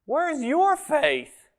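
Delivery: high, slow and drawn out, a gentle rebuke; Jesus rebuking. Retold or imitated. Jesus to his frightened disciples, high, slow gentle rebuke. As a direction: stretch each word high like a teacher chiding. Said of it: "And Jesus says".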